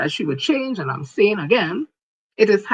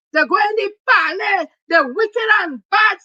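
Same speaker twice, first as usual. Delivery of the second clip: furious shout; 11 semitones higher, 6 dB louder than usual. Real, not acted. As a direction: hammer each word, loud and high. Chant against haters continues.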